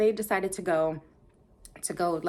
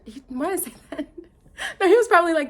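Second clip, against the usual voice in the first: laughing; 9 semitones higher, 6 dB louder, faster than usual. Real, not acted. Laughs at her own word choice.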